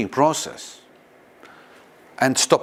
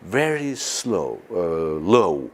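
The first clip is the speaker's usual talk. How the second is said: drawn out, a touch lower at the end; slow and low; 5 semitones lower, slower than usual. Shown as a demonstration. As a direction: stretch the words out slowly. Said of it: "speech inside become very slow, low".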